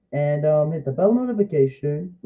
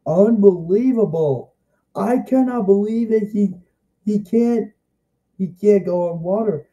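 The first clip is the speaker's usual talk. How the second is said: higher and louder, stretching out unbelievable; amazed disbelief; 5 semitones higher, 3 dB louder than usual. Retold or imitated. Kid retelling his reaction to Sonic, amazed disbelief. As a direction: gasp it out like you can't believe your eyes. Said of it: "and I was like".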